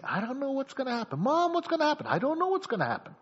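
put-on high-pitched worried kid pleading with mom; anxious child. Child panics at mother's request.

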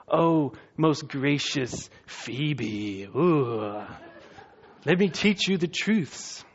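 very slow, put-on flattering creep voice; oily creep. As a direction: drag it out like a slimy false teacher sweet-talking a rich widow. Creeps flatter wealthy women.

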